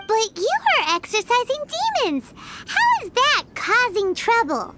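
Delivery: ordinary talk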